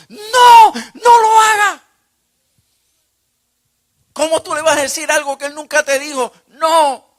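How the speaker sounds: tormenting voices screaming at the preacher not to write it; screaming inner voice. Retold or imitated. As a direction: shriek it like a voice assaulting your mind. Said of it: "si me estuviesen gritando".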